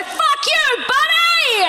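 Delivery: high, far yelled insult at the driver; mock road rage yell. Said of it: "and I go".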